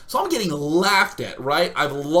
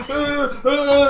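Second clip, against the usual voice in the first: high, acted-out yelps; panicked cry; 11 semitones higher, 7 dB louder than usual. Wasp escapes the punch, panicked cry. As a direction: yelp in alarm, twice.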